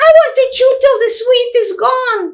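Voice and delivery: put-on a defiant small child; little girl's voice. Little sister protesting she won't be a person, high child voice. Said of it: "children's voice".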